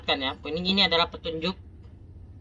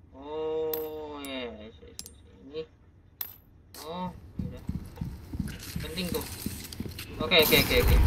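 ordinary talk

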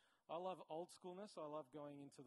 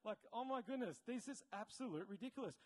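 incredulous outburst; 6 semitones higher, 5 dB louder than usual. Acted. Speaker reacting to today's society, incredulous. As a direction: burst out in exasperated disbelief. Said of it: "I'm just like".

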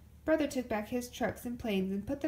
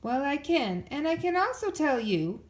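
louder, firm and higher; stern; 5 semitones higher, 6 dB louder than usual. A narrator's reading. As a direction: take charge firmly. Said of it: "said Mama sternly".

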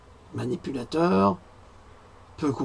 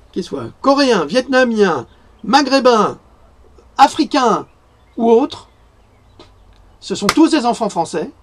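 louder and higher, rising passion; fervent declaration; 7 semitones higher, 8 dB louder than usual. Real, not acted. Defending all children born in France, fervent and loud. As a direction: proclaim it like a rallying cry.

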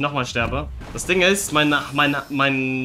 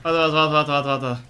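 urgent gamer panic; frantic; slower than usual. Real, not acted. Frantic fight in game. (hektisch und aufgeregt)

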